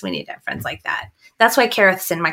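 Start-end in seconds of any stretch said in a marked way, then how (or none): 0.0-1.1 a soft unvoiced whisper of the same line; hushed echo, breath only. Real, not acted. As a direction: drop to a secret whisper for emphasis. Whispered repeat of her line.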